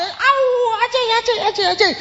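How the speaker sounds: high pained cries as the stones hit; Stephen crying out in pain. Acted. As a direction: wail it like you're being stoned.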